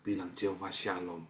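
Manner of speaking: low, soft prompt; soft invitation to declare; quiet. Shown as a demonstration. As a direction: say it gently first. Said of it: "yang keras".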